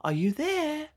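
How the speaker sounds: put-on high, bright little girl calling at the door. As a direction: call sweetly through the door in a high child voice.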